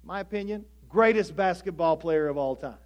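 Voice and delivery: normal talking voice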